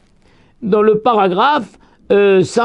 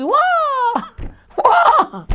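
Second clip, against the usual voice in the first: much higher, drawn-out wouah with a laugh; joyful cry. Retold or imitated. Rabbi acting out the damned crying for joy in fire. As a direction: whoop with glee. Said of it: "ils disent".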